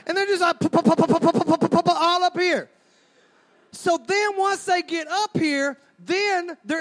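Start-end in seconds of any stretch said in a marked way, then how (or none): 0.6-2.7 mock choir. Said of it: "She's got a dadgum choir up here".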